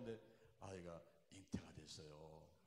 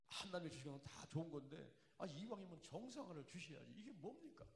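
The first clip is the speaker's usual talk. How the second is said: rising complaint; 9 semitones higher than usual. Retold or imitated. Retold complaint, pitch climbing.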